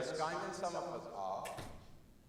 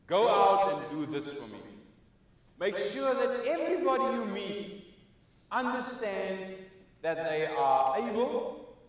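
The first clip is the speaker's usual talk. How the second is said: rousing closing appeal; 5 semitones higher, 7 dB louder than usual. Acted. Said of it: "keynote closing exhortation".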